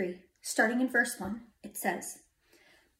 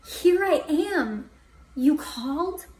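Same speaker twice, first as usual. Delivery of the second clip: raised, eager answer ending in a question; Samuel; 5 semitones higher, 4 dB louder, slower than usual. A narrator's reading. Boy running to his master, eager answer. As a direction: report for duty, expectant.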